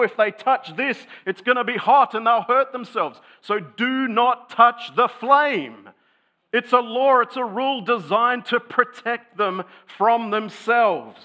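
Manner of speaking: loud, high-pitched, driving sermon delivery; emphatic preaching. Real, not acted. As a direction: preach it with rising force and urgency. Preaches forcefully on need for law.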